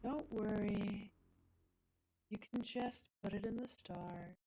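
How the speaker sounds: hushed ASMR voice; coding ASMR. Pretend. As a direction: murmur it like an ASMR reassurance. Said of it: "some code A S M R".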